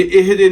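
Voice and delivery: इहदे with a loud added vowel on the ha; wrong ihde. Shown as a demonstration. Teacher mocking the common mistake, loud wrong vowel. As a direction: stress the wrong vowel after ha. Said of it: "नहीं है".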